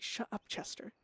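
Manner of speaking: whispered, annoyed; hissed shut up. Retold or imitated. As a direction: snap at him under her breath. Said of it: "and she's like".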